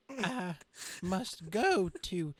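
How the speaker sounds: mock solemn departure; hammed-up exit. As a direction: stretch out the exit line grandly. Character voice exit line.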